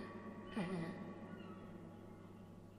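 soft mimicked bird laughter; imitated bird laugh. Podcaster copies a bird that's laughing, soft mock laugh. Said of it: "there's one that's laughing".

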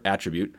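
attribute with its first syllable stressed. Shown as a demonstration. Teacher repeating the noun example, attribute stressed on first syllable. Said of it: "with the emphasis on the first syllable".

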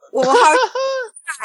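retelling the dad's laugh, mock chuckle; a slow, amused laugh at his son; father laughing at his son's naive boast. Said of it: "He starts laughing".

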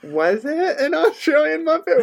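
very high, laugh-choked teasing question; incredulous laughter. Real, not acted. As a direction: squeal it through giggles. Cracking up at the bad accent.

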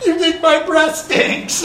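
offended daughter. Retold or imitated. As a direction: squeal it like a hurt, indignant girl. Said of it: "She's like".

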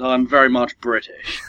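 a slow, put-on proper British accent; slow it down and do proper British English; a light, slowed show of a proper British accent. Shown as a demonstration. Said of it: "if I put on my proper accent".

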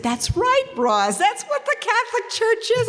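high, eager excited retelling; imitating her brother bursting with excitement. Brother excitedly tells his sister she's right, high and eager. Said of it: "And he got all excited".

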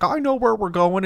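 high, sure-of-itself dog voice; cocky dog voice. Pretend. Dog on a trail thinks it knows the way, high and cocky. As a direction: voice the dog as smugly certain. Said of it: "and it's like".

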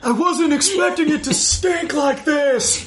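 high, laughing squeal of a character overwhelmed by smell; mock disgust. Hosts act the nest scene, giggly high disgust. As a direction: play a whiny victim of the stench, laughing.

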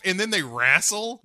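ordinary delivery

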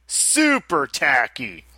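super tacky said slow and deliberate; drawn-out emphatic repeat. Retold or imitated. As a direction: lean on "Super tacky" slowly, both words. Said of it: "he wrote it twice like that".

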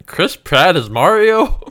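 loud, high, drawn-out question; stretched-out disbelief; incredulous. Real, not acted. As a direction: balk at it, pitch climbing at the end. Co-host balks at the casting.

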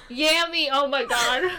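usual speaking voice

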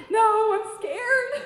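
high, slow, drawn-out mock fear; playful scared confession, stretched long. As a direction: whine it out, half laughing. Scared answer, sung stretched.

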